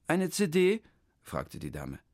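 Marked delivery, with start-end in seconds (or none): 0.1-0.8 high, chirpy clerk voice; the clerk rattles off her greeting, high; official lady. Acted. The town-hall clerk greets Hermann, high and chirpy.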